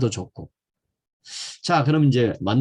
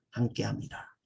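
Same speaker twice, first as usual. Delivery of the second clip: hushed blessing; 2 semitones lower, 12 dB quieter than usual. Real, not acted.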